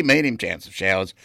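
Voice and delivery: usual speaking voice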